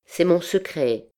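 understated gravity; grave reply. A narrator's reading. The Egyptian answers Fleur de Lys's question, gravely. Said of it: "lui répondit gravement".

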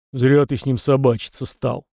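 ordinary delivery

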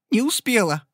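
high, a touch of a sob; sobbing reply. A narrator's reading. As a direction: squeak it out, near tears. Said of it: "всхлипнула Мэри". (со всхлипом, тонко)

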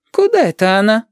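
put-on high, inquisitive old granny; high-voiced prying grandmother; prying question. A narrator's reading. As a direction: ask it nosily, pitched well up. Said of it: "пытливо спросила она".

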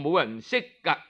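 plain reading voice

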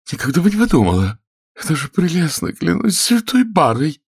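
high, gushing feminine delight; high-pitched amorous excitement; excited lady. A narrator's reading. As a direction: gush in a high, thrilled woman's voice. Lady's excited amorous babble.

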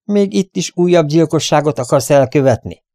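high, loud accusing question; angry. A narrator's reading. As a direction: attack him with the question. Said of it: "támadt Rogers dühösen Derrickre".

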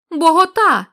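shouted call. Acted. Blind villager calling out to Nunez, loud shout. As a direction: call out for him across the field. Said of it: "крикнув один".

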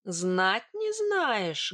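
very slow, drawn-out challenge; menacing, stretched-out question; angry disbelief. Acted. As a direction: echo it slowly with restrained anger. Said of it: "гневно прищурилась я".

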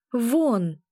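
emphatic narrated command. A narrator's reading. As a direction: banish the intruder firmly. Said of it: "приказала я".

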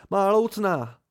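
loud, high cry of alarm; startled yelp. A narrator's reading. Narrator panicking about his missing lute, startled yelp. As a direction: blurt it out in a panic. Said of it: "vyjekl jsem".

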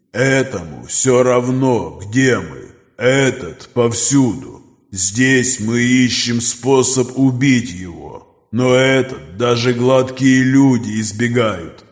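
slow, distant predatory creature; monster voice. Acted. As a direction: speak like a cold ancient beast. Creature Nokris speaks.

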